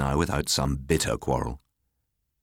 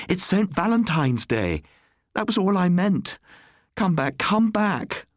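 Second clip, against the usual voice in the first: much higher and louder, slowing to a wail; desperate plea; 3 dB louder than usual. A narrator's reading. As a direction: beg him to come back. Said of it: "she cried".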